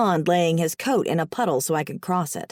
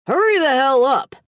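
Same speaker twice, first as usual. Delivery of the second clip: loud, high impatient shout; yelling; 6 semitones higher, 6 dB louder than usual. A narrator's reading. Boss waiting on his coffee, yelling impatiently. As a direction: yell it at a dawdling employee. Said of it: "he yelled".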